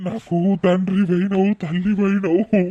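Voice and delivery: sobbing plea of a man overwhelmed by debt to his brother; tearful gratitude. Acted. Weeping gratitude to his rescuer brother. (ఏడుస్తూ కృతజ్ఞతతో)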